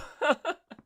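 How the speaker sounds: sudden high cackle; high burst of laughter. Real, not acted. Host cracking up at own joke, high laugh.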